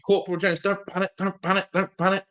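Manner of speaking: a hint of Corporal Jones flapping, not shouting; Corporal Jones impression. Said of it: "No, Corporal Jones, isn't it?".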